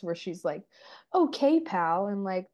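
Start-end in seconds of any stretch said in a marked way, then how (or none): 1.1-2.1 put-on high, loud childish retort; hammed-up kiddish sass, pitched up; overplayed childlike Paige. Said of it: "overplaying Paige's childlikeness".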